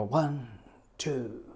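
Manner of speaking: soft, spaced-out count; slow ballad count-in. Shown as a demonstration. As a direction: count the band gently into a ballad. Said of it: "if you s-, count like that".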